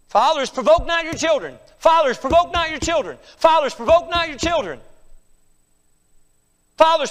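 high, loud pulpit exhortation; forceful preaching. Real, not acted. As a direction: drive each command home.

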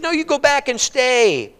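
high, slow, insistent plea; insistent refusal. Acted. Naomi refuses to let her daughters-in-law come, insistently. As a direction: send them away, stretching it out high. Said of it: "she doesn't want him to come".

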